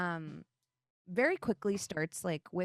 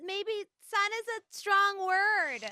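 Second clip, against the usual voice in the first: much higher, hedging goddess voice; Ahavara; 10 semitones higher than usual. Acted. Turtle goddess distancing herself from Freely, high hedging voice. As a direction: pitch it up and waver over the admission. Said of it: "this is when you hear Ahavara say".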